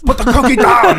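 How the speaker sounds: high, loud action-hero bark; Schwarzenegger voice. Retold or imitated. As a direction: shout it like Arnold in a movie. Quoting Jingle All the Way.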